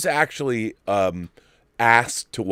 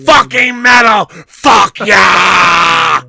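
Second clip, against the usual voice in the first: much higher and louder shout; triumphant shout; 6 semitones higher, 10 dB louder, much slower than usual. Real, not acted. Host cheering his own show to viewers, full shout. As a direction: roar it like a metal fan.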